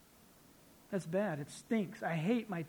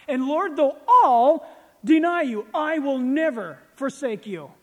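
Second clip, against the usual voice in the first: Peter's bold vow; 6 semitones higher, 10 dB louder than usual. Retold or imitated. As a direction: swear loyalty with full force. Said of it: "Peter stands there and goes".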